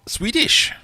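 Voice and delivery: high, loud triumphant reveal of the answer; excited reveal. Real, not acted. Quiz host revealing the answer to viewers, excited. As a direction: announce it like a winning prize. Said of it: "It was indeed".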